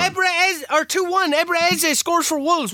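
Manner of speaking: high, exasperated commentary; agitated match call. Real, not acted. Player watches himself start losing, agitated call. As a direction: call the goal against you in disbelief. Conceding while calling the match.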